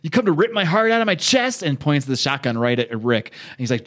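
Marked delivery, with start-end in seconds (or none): 0.0-1.6 high, heated confrontation; acted angry challenge. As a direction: challenge him bitterly, acting out a showdown. Acted armed quarrel between two friends.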